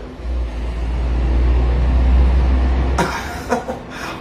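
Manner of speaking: long airy laugh; real breathy laugh. Real, not acted. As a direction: laugh it off, amused. Laughing at the politician.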